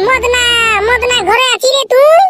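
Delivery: high, drawn-out call to a house. Acted. Villager calling a neighbour out of his house. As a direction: yell Madna's name across the yard.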